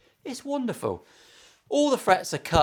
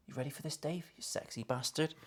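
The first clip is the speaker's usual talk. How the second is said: hushed aside; 14 dB quieter than usual. Real, not acted. Quietly bringing Dave in for close-up.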